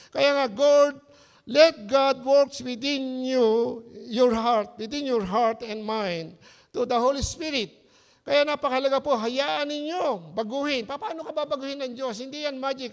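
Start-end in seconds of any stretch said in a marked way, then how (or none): 0.1-10.8 loud, slow, drawn-out pulpit exhortation; fervent preaching. Real, not acted. Pastor urging the congregation to let God change them, preaching at full force. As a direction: preach it out, stretching each phrase.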